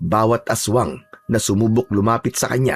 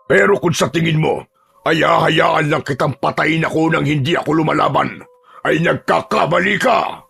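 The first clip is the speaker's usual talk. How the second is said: defiance building to shout; 6 semitones higher than usual. Acted. Amaro defying the killer of his family, building to a shout. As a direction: refuse to die without a fight. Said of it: "Malakas na sumigaw si Amaro".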